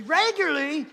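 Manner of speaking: "regularly" punched high, loud and stretched; highlighted REGULARLY. Shown as a demonstration. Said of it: "you'll highlight regularly".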